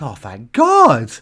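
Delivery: loud, drawn-out cry of relief; huge relief. Retold or imitated. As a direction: let the relief burst out slowly. Retold relief after the panic.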